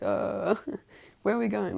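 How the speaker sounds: lightly acted high-pitched bewilderment; mock protest while dragged. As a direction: pitch it way up, as if tugged along by a collar. Acting Todoroki being dragged by collar.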